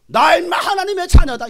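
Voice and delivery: high, loud comeback; defiant retort. Retold or imitated. Preacher retelling his reply to a demon, defiant shout. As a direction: indignantly pull rank on it.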